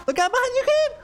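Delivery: sudden high yelled warning; alarm shout. Real, not acted. Warning a teammate in game.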